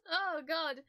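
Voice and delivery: teary sigh of real emotion; overwhelmed, weepy exclamation; crying. Real, not acted. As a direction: let the tears break through. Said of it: "Why am I crying so much".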